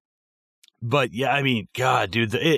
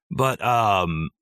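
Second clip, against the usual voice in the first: ordinary talk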